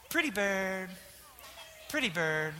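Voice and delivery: lightly voiced, soft cooing pretty bird; Dumb and Dumber quote. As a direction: coo it softly like the blind kid. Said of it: "Does anyone know what I'm quoting?".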